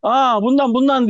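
a lightly voiced customer insisting on this one; pointing customer. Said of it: "diyor, eliyle gösteriyor".

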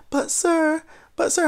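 high, timid little voice; little soft voice. Retold or imitated. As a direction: imitate a small, high pleading voice. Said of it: "a little soft voice was happening".

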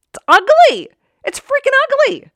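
loud, very high-pitched exclamation; high, emphatic cry; emphatic outburst. Real, not acted. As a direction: burst out high and loud with disgust. Condemns her own negative self-talk.

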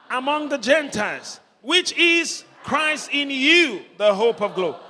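high, loud scripture call-out; rallying preacher. Real, not acted. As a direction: lead the congregation in reading it out loud. Leads congregation reading scripture.

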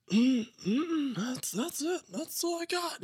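high, strained mock squirming; nervous denial. Sponsee dodging sponsor's last question, nervous high-pitched denial. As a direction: squeak it out like someone hiding a secret. Sweating sponsee hides a secret.

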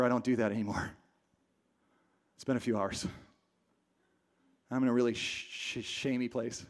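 low, soft, deflated confession; ashamed mumbler. Acted. Shamed believer answering the accuser, low and deflated. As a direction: sink down into a sheepish, defeated murmur. Voices a person sunk in shame.

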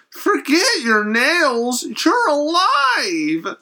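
high, slow, stretched-out disbelief; astonished exclaim. Retold or imitated. Husband to wife back from grave, high slow astonishment. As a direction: draw each word out high in amazement.

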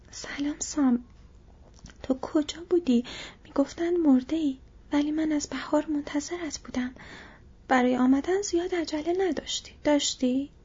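put-on a teasing young woman chiding Sam; Rosie, young hobbit lass. Rosie voiced higher. (صدای دختر جوان با گلایهٔ ملایم)

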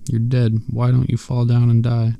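usual speaking voice

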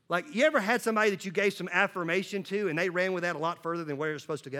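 ordinary talk

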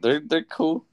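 holding back a laugh; trying not to crack up; suppressed laughter. Real, not acted. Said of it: "sounding like you're trying not to laugh".

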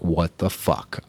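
low, slow, soft what the fuck; deflated disbelief. Retold or imitated. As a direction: let it sink out of you, crushed by the number. Steps on scale, dismayed.

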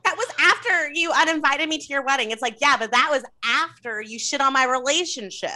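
high-pitched indignant comeback; acted-out quarrel. Acted quarrel over the wedding.

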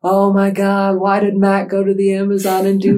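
high, loud mock wail; imitated screaming. Mourners at his funeral, mock screaming lament. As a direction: cry it out in hammed-up grief, breaking into laughter. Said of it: "And they're screaming".

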